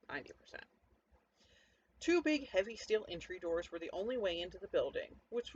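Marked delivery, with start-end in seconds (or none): 0.1-0.7 breathy whisper, dropped almost to nothing; sheepish whispered correction to the listener; whispered aside. Acted. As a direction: confess it under your breath like a guilty afterthought. Sheepish correction of stolen stash.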